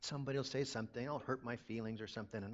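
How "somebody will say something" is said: ordinary talk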